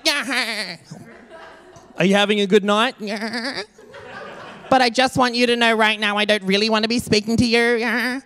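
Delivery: a hint of a spaced-out party girl, mocking; mock bar girl. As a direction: lightly play the dreamy girl in the bar. Impersonating fake-tan girl in bar.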